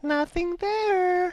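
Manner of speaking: very high, slow quoted voice; high funny voice. Retold or imitated. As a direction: squeak it out slowly. [name] repeats the quoted line.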